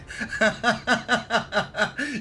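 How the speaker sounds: real high-pitched laughter; high burst of laughter. Real, not acted. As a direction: crack up at the joke.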